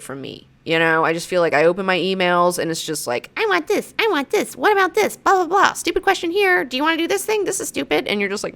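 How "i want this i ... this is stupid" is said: high, nagging put-on voice; voicing pushy emailers, high and mocking; mock demanding emailers. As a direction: rattle off the demands like pesky emailers.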